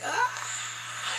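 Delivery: high, airy drawn-out "ah"; hammed-up high moan; imitated pleasured cry.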